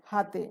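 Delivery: হাতে said with an initial h in place of p; dialect h sound. Shown as a demonstration. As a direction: say হাতে, h replacing the p of পাতে. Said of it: "পাতেকে বলে".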